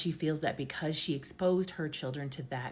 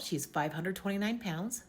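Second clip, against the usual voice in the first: ordinary talk, nothing marked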